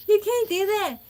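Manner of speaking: high, loud laughing protest; shocked protest. Retold or imitated. Speaker to her sister after the rude man, high laughing outcry. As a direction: squeal it in disbelief.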